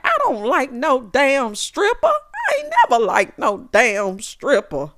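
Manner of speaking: hammed-up disapproving mama voice; imitating Mama Joyce. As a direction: drag it out like a fed-up mother. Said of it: "Mama Joyce was like".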